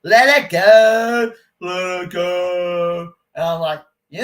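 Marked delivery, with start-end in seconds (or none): 0.0-3.1 slow, drawn-out singsong, louder at first; stretched mocking singsong; mocking advice. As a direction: parody a preachy "let it go". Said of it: "And people say".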